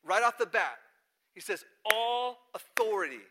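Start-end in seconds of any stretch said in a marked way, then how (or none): 1.8-3.2 "All" stretched long and loud; big "All". Retold or imitated. Jesus gives the Great Commission, big stress on "All". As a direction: hit "All" hard, slowly and higher. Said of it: "That's a big all".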